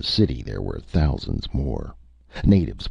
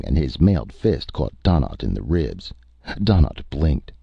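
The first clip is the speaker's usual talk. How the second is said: ordinary delivery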